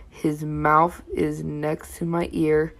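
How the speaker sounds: ordinary delivery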